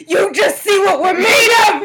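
loud defiant yell; defiant shout. Real, not acted. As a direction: shout it out at the haters. Defiant boast to haters.

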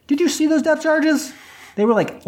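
high-pitched incredulous crew member; incredulous retort. Retold or imitated. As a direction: protest in a high, disbelieving voice. Crew retorting to angry map guy.

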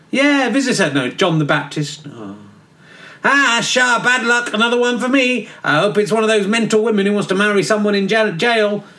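cheeky geezer John. Acted. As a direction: play John as a careless lad in a cell. John the Baptist as bloke.

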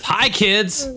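put-on cheesy kids' host hello. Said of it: "he would start it with".

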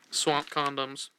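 ordinary talk

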